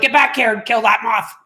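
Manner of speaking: lightly put-on bossy voice; moth-killing command voice. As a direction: playfully order him back to do it. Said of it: "I almost said it just like that".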